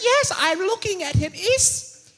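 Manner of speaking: put-on high, very slow, tearful child voice; crying little boy. Son asks if his father will die, high tearful child. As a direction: stretch it out like a kid about to cry. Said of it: "He says".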